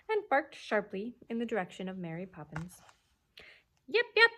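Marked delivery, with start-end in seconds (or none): 3.9-4.3 high sharp little barks, louder; quick dog yaps. A narrator's reading. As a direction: yap it out like a small dog. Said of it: "said Andrew several times very quickly".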